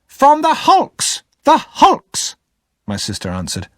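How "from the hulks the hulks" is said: high, loud and slow, hammering out each word; impatient shout. Acted. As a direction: shout it at the boy, exasperated. Missus Joe answering impatiently.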